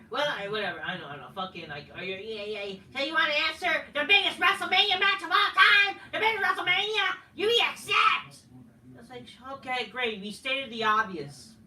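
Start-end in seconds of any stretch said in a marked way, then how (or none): 2.9-8.4 higher, louder hammed-up challenge; a mocking imitation of a wrestler's big challenge; mock promo voice.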